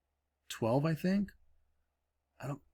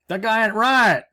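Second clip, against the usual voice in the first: mock Joe Exotic accent, hammed up; rough Oklahoma-accent take on Joe Exotic; Joe Exotic impression; 10 semitones higher, 8 dB louder than usual. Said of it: "I don't have the Joe Exotic, ... Accent down".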